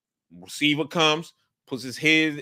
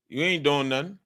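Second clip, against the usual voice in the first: ordinary talk, nothing marked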